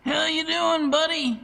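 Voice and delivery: high, hearty bellow of a big warehouse guy; Bert bellowing. A narrator's reading. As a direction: boom it out like a jolly fat forklift driver. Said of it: "he bellowed".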